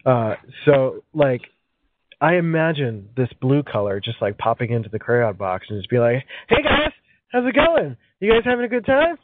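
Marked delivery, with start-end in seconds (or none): 6.5-9.2 high, peppy party greeter voice; excited party host. Pretend. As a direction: greet the crowd like an eager host.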